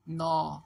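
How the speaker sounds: नौ held long as open "naw"; syllable sound demo. Shown as a demonstration. Teacher answers what the letters make, drawn-out नौ. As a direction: stretch नौ as one firm syllable. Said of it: "नियन और ओ क्या बनेंगे?".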